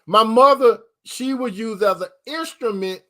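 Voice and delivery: a sudden shouted my mother; preaching shout. Real, not acted. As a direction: fling the words out to the church. Preacher punching a phrase.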